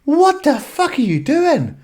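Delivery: a high, loud, slow cry of alarm; doctor screaming in a panic; panicked doctor yell. Retold or imitated.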